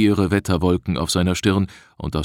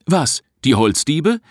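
high, rising query; puzzled question; 9 semitones higher, 3 dB louder than usual. A narrator's reading. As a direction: ask back in surprise, pitched up. Subordinate asks, puzzled by the curse. (verwundert nachfragend)

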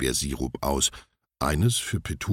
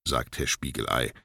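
ordinary delivery, nothing marked

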